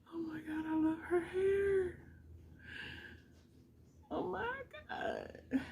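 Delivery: soft, high, breathy awe; almost whispered wonder; hushed awe. Real, not acted. Admiring the mannequin's hair in hushed awe.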